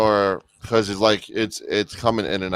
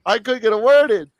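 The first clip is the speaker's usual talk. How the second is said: imitating Tenth; 10 semitones higher, faster than usual. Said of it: "And then he's like".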